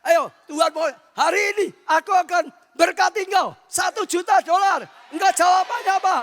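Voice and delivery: loud, high-pitched revival preaching; shouting sermon from the pulpit; fiery preaching. Acted. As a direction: preach it out at full force. Preacher retells, full force.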